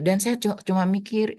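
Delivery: ordinary talk, nothing marked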